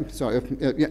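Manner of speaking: normal talking voice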